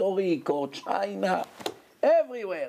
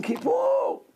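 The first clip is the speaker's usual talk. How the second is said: Kippur cried out much higher; retold scream. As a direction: shout Kippur like the injured grandmother. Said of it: "She was screaming".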